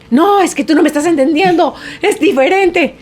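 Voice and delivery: lightly voiced, whiny teen insisting; indignant son. Grandson defending himself, retold.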